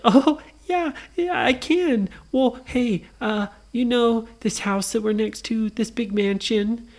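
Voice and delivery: put-on high young heroine voice; eager little Nora. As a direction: pitch it up, friendly and curious.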